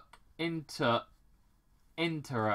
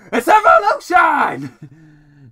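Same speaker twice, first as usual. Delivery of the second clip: drawn-out, high, full-force yell; villainous shout; 12 semitones higher, 19 dB louder, slower than usual. Acted. Robotnik launches his drones with a triumphant shout. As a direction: bellow it slowly like a triumphant villain.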